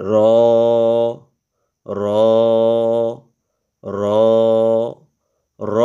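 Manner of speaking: র said with a clear rolled r; Arabic ra. Shown as a demonstration. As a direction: roll the r in র. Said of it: "আমরা র পড়ি না".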